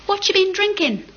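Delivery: high, curious and playful question; twinkling old man. A narrator's reading. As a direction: ask it with a gleam of interest. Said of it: "his eyes twinkling".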